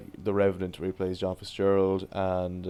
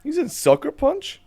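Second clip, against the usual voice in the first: sudden squeaky jump way up on surprise; voice cracks up high; 5 dB louder than usual. Real, not acted. Listing Tom Hardy films, voice cracks high in surprise. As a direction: voice breaks high in disbelief. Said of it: "I have a deep voice here".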